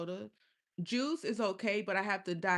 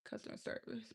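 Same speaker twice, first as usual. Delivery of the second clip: whispered; 13 dB quieter than usual. Shown as a demonstration. Whispered repeat of the phrase.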